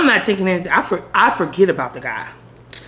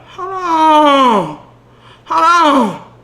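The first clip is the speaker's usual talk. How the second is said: higher, louder hold on hold on; shocked; 8 semitones higher, 5 dB louder, much slower than usual. Retold or imitated. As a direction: stop him, thrown off. Shocked at his proposition.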